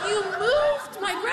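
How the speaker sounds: high, slow, laughing disbelief; can't believe what grandma just said; shocked disbelief. Retold or imitated. Shocked reply to grandma's news.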